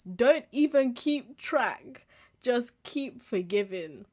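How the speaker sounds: each word slowed and leaned on; a slow, deliberate preacher-like emphasis; slow emphatic quote. Acted. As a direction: lay it down slowly, word by word. Repeating the verse emphatically.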